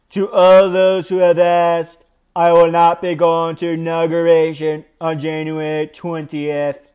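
deliberate, drawn-out presidential impersonation; Donald Trump impression. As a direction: impersonate the president reading a statement, word by word. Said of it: "I'm gonna read it in his voice".

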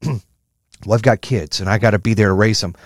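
mocking parent making excuses. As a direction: mimic a lame excuse-maker. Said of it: "a fucking bullshit excuse of".

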